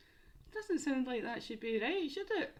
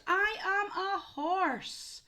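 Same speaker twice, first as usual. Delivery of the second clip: slow, higher and louder horse reveal; excited reveal; 10 semitones higher, 5 dB louder, much slower than usual. Shown as a demonstration. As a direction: announce it like a big surprise. Reveal in animal's voice.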